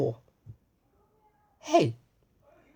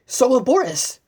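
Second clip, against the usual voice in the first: excited; 6 semitones higher, 6 dB louder than usual. Acted. Happy closing line.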